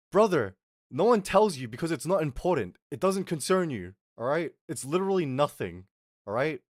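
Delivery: exasperated retort. Retold or imitated. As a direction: act out setting a brother straight, emphatically. Retold quarrel, answering the brother.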